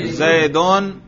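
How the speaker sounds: "زیدون" ending clearly in -un; raf' ending. Shown as a demonstration. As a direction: say "زیدون" with a final "un" sound. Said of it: "اس کے رفع پر ہم تلفظ کریں". ("زیدون" رفع کے ساتھ، آخر میں "اُن")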